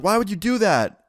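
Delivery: high-pitched mock dismay; put-on high voice of shocked complaint; protesting ex-girlfriend. Retold protest of pushed ex-girlfriend.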